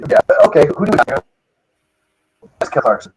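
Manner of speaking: choppy, dropping-out call audio; radio breaking up. Real, not acted. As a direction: voice cutting in and out. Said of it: "[name], you're breaking up a little bit".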